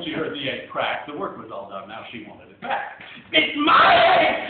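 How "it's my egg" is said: high, loud, slow furious outburst; angry sputter. Acted. Maisie demands the egg back, loud furious sputter. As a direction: spit it out in outrage. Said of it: "she sputtered".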